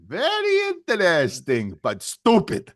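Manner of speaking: high start, then drop loudly on the punchline; put-on sketch-comic catchphrase voice; Arte Johnson. Host quoting Laugh-In to co-host, comic impression. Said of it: "Arte Johnson from Laugh-In".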